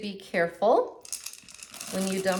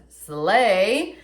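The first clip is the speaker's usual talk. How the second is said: sassy slay; 10 dB louder than usual. Retold or imitated. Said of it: "as she says".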